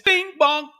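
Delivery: high loud doorbell ding-dong; bing bong chime. Pretend. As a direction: sing it as a chime. Covid chime gag repeated.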